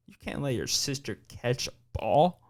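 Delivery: ordinary talk, nothing marked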